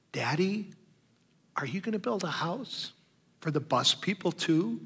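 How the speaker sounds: put-on high, soft small-girl voice; little daughter. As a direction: voice a three-year-old tugging a sleeve. Three-year-old daughter asks question.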